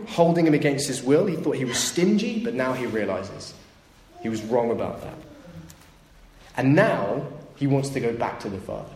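ordinary delivery

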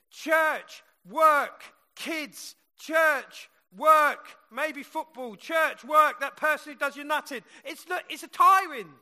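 loud, rhythmic pulpit build; preaching at full force. Acted. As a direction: hammer each word from the pulpit. Preaching, rising to loud repetition.

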